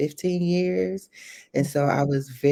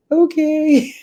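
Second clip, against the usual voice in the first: much higher and louder, startled little okay; meek okay; 12 semitones higher, 9 dB louder than usual. Retold or imitated. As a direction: give in with a sudden squeaky okay. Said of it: "And I was like".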